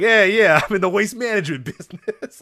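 laughing through a fake Chicago gangster accent; mock Chicago accent. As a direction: ham up a bad Chicago Italian accent. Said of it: "He's trying to do a Chicago accent".

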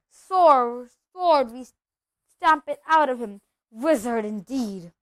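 loud, emphatic scornful outburst; indignant bluster. Acted. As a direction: bluster with outrage. Uncle Vernon's angry outburst.

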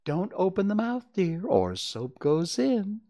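unruffled instruction, slowing at the end; motherly warning. A narrator's reading. Mother bathing a struggling child.

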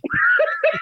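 big high burst of laughter; real loud laugh. Real, not acted. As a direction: crack up loudly. Laughing at Father Pat.

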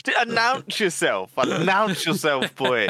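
mock call-out. Mock calling out the listener.

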